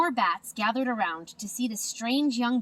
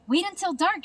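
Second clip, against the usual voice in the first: higher, eager call; excited; 9 semitones higher, 4 dB louder than usual. A narrator's reading. Stellaluna eagerly telling the birds to wait, higher. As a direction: bubble over with eagerness. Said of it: "Stellaluna said excitedly".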